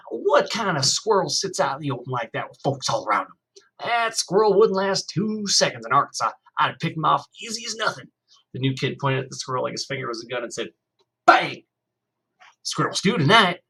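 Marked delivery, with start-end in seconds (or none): 0.1-8.1 put-on Arkansas boy. A narrator's reading. New kid bragging to Kenny about Arkansas squirrels, boastful country voice. 11.3-13.6 high, loud mock bang, then a grin; playful gunshot. A narrator's reading. New kid pretending his finger is a gun, playful loud bang.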